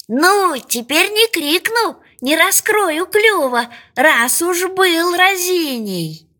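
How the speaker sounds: lightly voiced duckling, pitched high; a high little voice talking itself into resolve; determined duckling. A narrator's reading. Duckling promises itself not to drop the fish, lightly determined.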